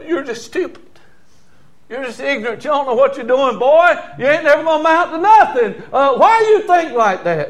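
high scolding that builds to loud; mock angry dressing-down, rising in force; berating parent. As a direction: imitate a parent tearing into a child.

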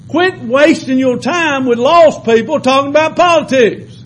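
a preacher hammering a point home to the church; preaching at full force. Acted. Preacher urging the church to stop wasting time, full-force preaching. Preacher exhorting the congregation loudly.

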